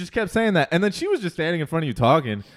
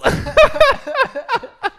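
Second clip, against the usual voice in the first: high burst of laughter; loud laughter; 8 dB louder than usual. Real, not acted. Hilarious line, big laugh.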